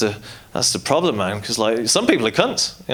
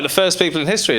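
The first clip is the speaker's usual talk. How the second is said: ordinary talk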